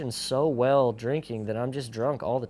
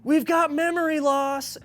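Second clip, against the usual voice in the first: mock paramedic; 7 dB louder than usual. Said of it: "And he's like".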